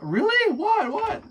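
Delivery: very high, loud squeaky what; high mock squeal of disbelief; squealing surprise. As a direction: squeak it out in shock.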